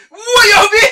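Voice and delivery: high, loud startled yelp; startled cry. Retold or imitated. Driver spotting the ruined temple, yelping in shock. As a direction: jolt as the wreck of a temple looms up.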